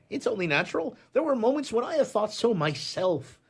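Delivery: normal talking voice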